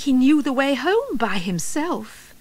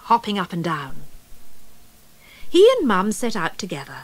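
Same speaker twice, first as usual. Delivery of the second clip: usual speaking voice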